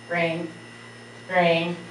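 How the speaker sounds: ordinary delivery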